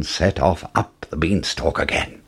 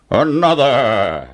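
giant's order; 3 semitones higher, 3 dB louder, slower than usual. Acted. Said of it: "ordered the giant".

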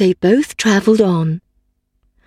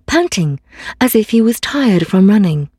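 ordinary delivery

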